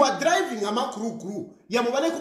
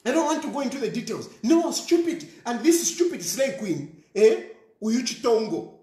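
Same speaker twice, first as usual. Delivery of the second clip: ordinary talk, nothing marked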